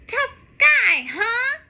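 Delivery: loud, high cocky challenge; taunting. Acted. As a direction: sass the hawk. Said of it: "Streaky says".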